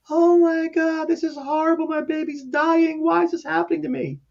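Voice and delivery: retold distraught wail. Caseworker retelling a dying girl's mother outside, distraught wail. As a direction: imitate a mother wailing, high and sustained.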